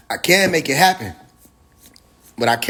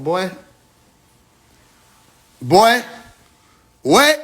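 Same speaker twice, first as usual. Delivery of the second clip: mock disbelief; 4 semitones higher, much slower than usual. Playing stunned after launching phone, mock disbelief. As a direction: act stunned, voice climbing high. Imagined reply to phone critics.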